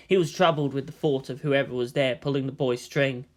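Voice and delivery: plain reading voice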